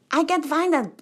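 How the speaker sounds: loud, high, uncertain mock accent; attempted Nigerian accent. Retelling the PhD's complaint, attempted Nigerian accent. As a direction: try out a half-remembered Nigerian accent. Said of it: "I forgot his accent".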